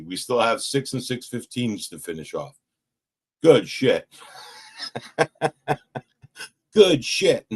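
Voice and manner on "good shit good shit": laughing. Real, not acted. Laughing relief.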